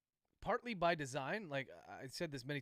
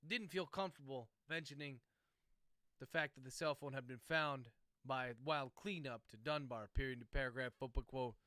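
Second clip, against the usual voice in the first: dictating; 4 dB louder than usual. Real, not acted. Author dictating novel chapter.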